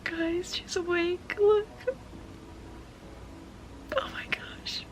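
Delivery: high, soft coo sinking to a whisper; hushed delight. Real, not acted. Owner tells viewers she's awake, hushed and excited. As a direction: keep it tiny and whispered. Cooing as the pet wakes.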